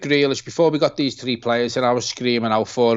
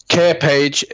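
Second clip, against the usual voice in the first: ordinary delivery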